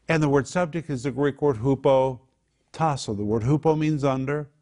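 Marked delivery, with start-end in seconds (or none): none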